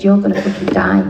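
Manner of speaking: low, blunt warning; stern sponsor. Retold or imitated. Tough sponsor warning the newcomer, low and blunt. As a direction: drop the voice low and level it at her. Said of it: "she came up to me and she said".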